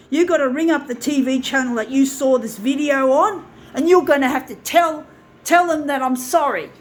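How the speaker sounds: loud, raw outburst at his father; angry rant. Real, not acted. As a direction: yell it out like years of rage breaking loose. Enraged rant addressed to absent dad.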